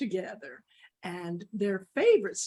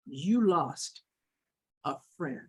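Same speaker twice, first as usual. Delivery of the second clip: grave hurt; 7 semitones lower than usual. Acted. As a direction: let it drop low and final. Dog solemnly ends the friendship.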